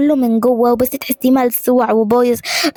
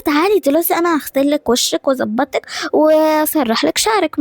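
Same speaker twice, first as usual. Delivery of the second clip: ordinary talk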